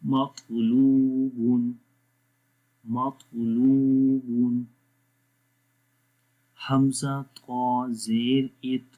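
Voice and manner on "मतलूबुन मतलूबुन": मतलूबुन with the लू stretched long and slow; long laam. Shown as a demonstration. Teacher modelling the long vowel, louder. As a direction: hold the लू in मतलूबुन. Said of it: "लाम को लंबा करें".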